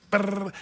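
quick fluttering lip-trill; playful vocal sound effect; arrow whizz imitation. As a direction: make the noise of an arrow flying.

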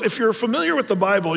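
normal talking voice

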